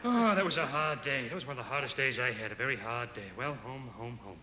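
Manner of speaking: a worn-out clerk talking himself home, trailing off to nothing; weary sigh. Acted. Worn-out clerk muttering to himself after a hard day, fading away.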